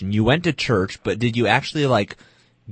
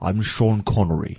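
put-on slow, lightly done Scottish Sean Connery accent; a so-so Scottish impression of Connery, slowed down. Co-host asked for his Sean Connery, a mild Scottish accent. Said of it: "Do your best Sean Connery accent".

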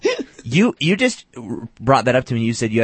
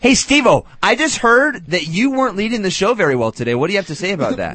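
mock tattling; 6 semitones higher than usual. Co-host pretending to report the host to the boss, mock tattling. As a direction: pipe up high like a snitch, then ease down.

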